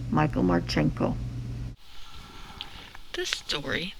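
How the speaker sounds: plain reading voice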